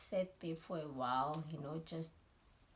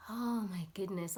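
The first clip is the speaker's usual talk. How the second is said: ordinary delivery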